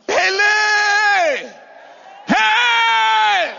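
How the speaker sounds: high, loud, drawn-out cry; shouted high, held long; ecstatic shout. Real, not acted. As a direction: belt out a preacher's exclamation. Ecstatic cry while preaching.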